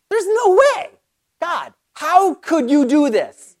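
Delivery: high-pitched disbelieving outburst, then drawn out; incredulous protest. Acted. Believer answering God in prayer time, incredulous. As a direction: protest in mock astonishment.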